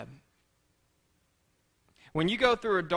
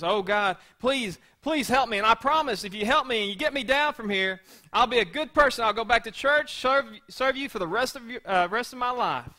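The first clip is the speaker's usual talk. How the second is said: higher, urgent acted begging; raised-pitch plea pouring out promises; pleading; 6 semitones higher, 4 dB louder than usual. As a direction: bargain desperately for rescue. Man on cliff begs God.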